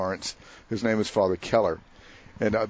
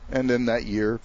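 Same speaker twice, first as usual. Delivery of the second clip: normal talking voice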